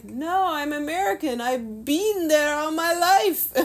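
high, loud hammed-up protest; mock indignant protest. Accused sailor insisting he's American, mock indignant and high.

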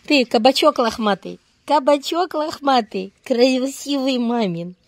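high cooing, slowing at the end; squeaky praising a dog. Real, not acted.

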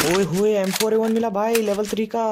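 high, loud burst of excitement; excited gamer whoop in words; thrilled exclamation. Real, not acted. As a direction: crow over a lucky weapon find. Thrilled at finding a gun.

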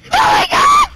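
loud high shocked scream; shocked shout. Acted. Shocked at being stabbed.

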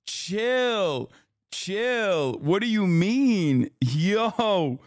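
high, drawn-out disbelief, gradually coming down; shocked protest. Real, not acted. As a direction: object in amused alarm, stretching each word. Reacting to shocking clip.

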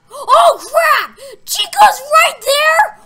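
loud, high shout of shock; panicked scream. Real, not acted. Player finds Chica right there, panicked scream. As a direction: scream out the sighting in alarm. Jump scare on camera.

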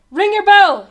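high, loud shouted order; urgent call. A narrator's reading. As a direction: call out to rouse the bell ringer. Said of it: "he called".

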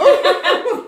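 startled burst of high laughter; jump scare laugh. Real, not acted. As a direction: laugh off a sudden fright. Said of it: "you scared me".

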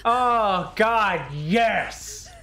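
high, drawn-out triumphant cries; gleeful, stretched-out exclamations; elated cheer. Real, not acted. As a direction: crow over a big win. Celebrates a catch in game.

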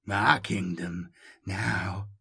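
slow, low, understated mockery; bitter mockery. A narrator's reading. Voice boasts to Jomi of its kingdom, bitterly mocking. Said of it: "Bitter mockery tinged the accent".

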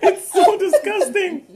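high, slow laughing disgust. Real, not acted. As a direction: laugh helplessly through the words. Tasting awful candy, laughing.

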